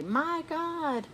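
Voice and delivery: high startled exclamation; surprised. Acted. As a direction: gasp it out in surprise. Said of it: "When I'm surprised or frightened, I say".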